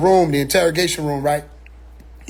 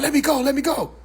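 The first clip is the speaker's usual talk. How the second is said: higher-pitched imitated plea; mock-frantic, repeated begging to be released; lightly acted panic; pleading; 6 semitones higher than usual. Acting movie actor shaking off grip.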